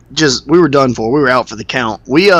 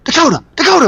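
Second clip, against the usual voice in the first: high, pleading whisper-call; mock urgent wake-up; 11 semitones higher, much faster than usual. Friend waking him at dawn, high and urgent. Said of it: "He goes".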